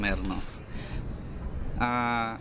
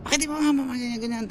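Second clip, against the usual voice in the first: mock yelling father; 10 semitones higher, 4 dB quieter, faster than usual. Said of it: "Pasigaw, sinisigawan yung bata".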